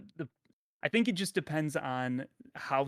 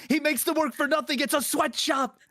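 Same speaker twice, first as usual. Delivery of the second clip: mock outrage; 13 semitones higher, 7 dB louder than usual. Mock conspiracy outrage about Santa.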